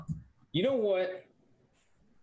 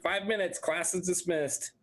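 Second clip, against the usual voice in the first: higher-pitched mock student voice; 8 semitones higher than usual. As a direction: play students gleefully quoting a class rule. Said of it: "We're like".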